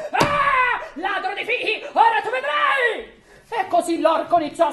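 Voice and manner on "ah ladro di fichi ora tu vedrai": put-on the ogre catching the boy in his tree; terrible ogre voice. A narrator's reading. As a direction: bellow a threat at the thief. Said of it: "una voce terribile".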